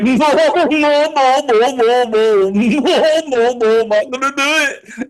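high, slow no-no-no dissolving into laughter; laughing refusal. Retold or imitated. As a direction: giggle through the denials.

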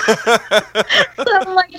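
ordinary talk, nothing marked

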